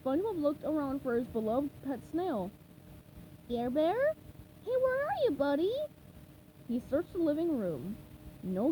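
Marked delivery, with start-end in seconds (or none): 3.5-5.7 high sponge voice coaxing a pet; calling pet sweetly. Acted. SpongeBob looking for Gary, high coaxing call. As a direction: call out gently for the snail.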